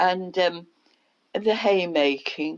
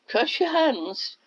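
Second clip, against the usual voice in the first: retold child urging; 11 semitones higher, slower than usual. Child urging mother again.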